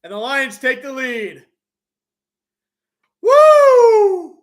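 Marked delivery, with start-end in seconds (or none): 3.2-4.4 long, high, loud "woo"; celebratory whoop. Real, not acted. Announcer celebrates the Lions taking the lead, high whoop. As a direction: let out a big sustained cheer. Cheering the touchdown.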